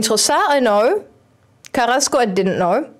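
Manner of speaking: ordinary delivery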